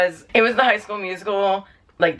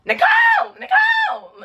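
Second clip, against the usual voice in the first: imitated hallway scream; 3 dB louder than usual. Grandma calling mom in the hall, imitated scream. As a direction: mimic a piercing call down the hall. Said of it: "my grandma calls my mom in the hall".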